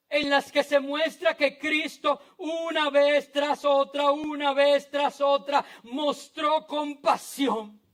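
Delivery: high, forceful pulpit delivery; ringing, emphatic proclamation; impassioned preaching. Real, not acted. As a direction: hammer the repetition home to the congregation.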